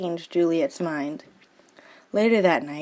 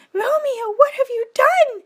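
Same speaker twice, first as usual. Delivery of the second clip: put-on much higher, louder girlish wail; wailing Juliet; 6 dB louder than usual. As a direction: cry out in horror at the deed. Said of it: "she wailed".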